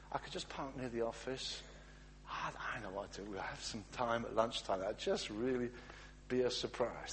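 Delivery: hint of a chatty wife praying. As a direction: drift through a distracted prayer. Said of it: "I'm gonna be a wife here".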